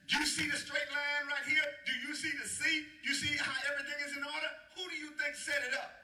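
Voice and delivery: a hint of God challenging Job; lightly voiced God. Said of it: "God was telling him".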